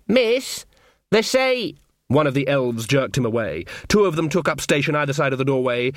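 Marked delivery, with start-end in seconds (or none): 0.1-1.8 put-on higher boy's voice, hesitant; timid young lad knocking at a door; nervous boy. Sean calling through the door to Magrat, nervous and high.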